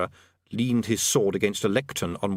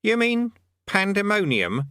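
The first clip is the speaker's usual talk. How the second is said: suspiciously; 11 semitones higher, 4 dB louder, slower than usual. Acted. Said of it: "said his captor suspiciously".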